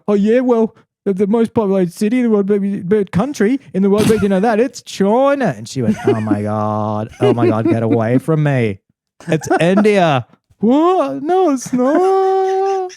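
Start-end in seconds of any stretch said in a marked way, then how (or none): 0.1-5.5 high, loud, fast cocky retort; hammed-up gloating know-it-all; smug comeback. Retold comeback at party. 6.1-10.2 mock exasperation. Retold woman's exasperation. 10.6-12.9 very high, slow, drawn-out wail of disbelief; hammed-up squealing protest; shocked denial. Retold own shocked denial.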